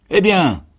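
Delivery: high, loud interjection; sergeant's retort. A narrator's reading. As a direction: throw it back loudly. (réplique forte et aiguë du sergent)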